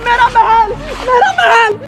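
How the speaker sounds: high wailing protest; plead, building to a long cry; desperate plea. Acted. Beggar dragged from his palace, desperate wail.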